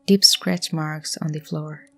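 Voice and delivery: hushed, measured horror narration; suspenseful story narration. Acted. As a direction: tell it like a creepy campfire tale.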